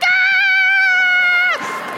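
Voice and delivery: very high, long mock scream; hammed-up girlish shriek. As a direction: shriek all the way down the drop. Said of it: "going down like a girl".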